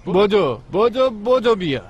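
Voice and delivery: calling brother to hurry over. Acted. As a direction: call him over eagerly. Calling him to come.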